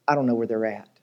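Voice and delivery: low, hushed and resigned; grave mourner's quote. Acted. Preacher voices family at a funeral, grave and hushed. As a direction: drop low and soft, as if at a funeral.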